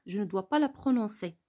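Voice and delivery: ordinary talk, nothing marked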